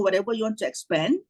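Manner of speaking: ordinary delivery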